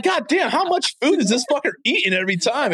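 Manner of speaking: imitating his own annoyed reaction on hearing the chewing; mock exasperation. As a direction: act fed up with the constant chewing. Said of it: "I'm like".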